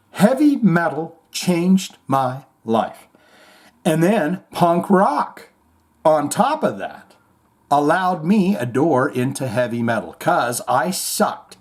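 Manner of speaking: slow, halting read of a quote; slow clumsy reading. Shown as a demonstration. Host reading the guitarist's quote aloud, slow and stiff. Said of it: "Why am I reading this like shit?".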